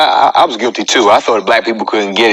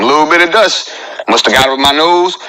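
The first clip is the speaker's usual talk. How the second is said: ordinary delivery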